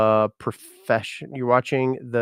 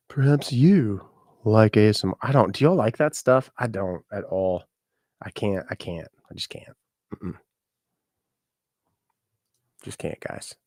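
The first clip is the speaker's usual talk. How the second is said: ordinary talk, nothing marked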